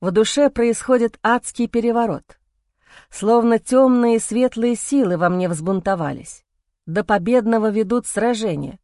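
ordinary delivery, nothing marked

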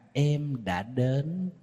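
very slow and soft, sugar-sweet. Shown as a demonstration. Wife texting her cheating husband, sweetly and very slowly. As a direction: let each word melt, honey-sweet. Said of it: "nó ngọt vậy đó".

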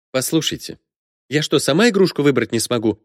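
snapping. A narrator's reading. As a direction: snap back at an intrusive offer of help. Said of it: "неожиданно огрызнулась покупательница".

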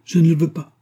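put-on a loud, firm no from the young woman; with authority. A narrator's reading. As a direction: lay down the law to him. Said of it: "dit-elle avec autorité".

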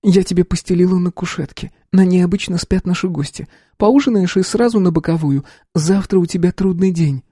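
a sister tucking her brother in for the night; caring sister. A narrator's reading. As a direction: sister offers her brother the couch, kindly. Sister settles him for night.

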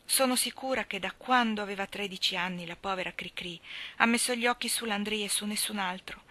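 ordinary delivery, nothing marked

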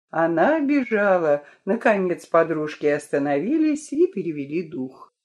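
usual speaking voice

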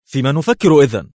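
high, loud sharp question; pressing him for an answer; exclaimed. A narrator's reading. Mona pressing Adham, a high loud cry. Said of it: "هتفت".

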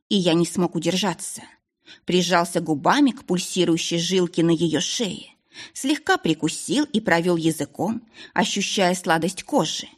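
ordinary delivery

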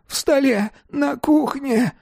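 high, frightened, strained voice; wheezing. A narrator's reading. Dealer reveals where money is, wheezing. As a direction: squeeze the words out in terror. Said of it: "засипел Рублёвка".

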